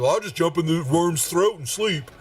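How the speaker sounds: high-pitched game character voice; Varus. Acted. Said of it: "Varus says".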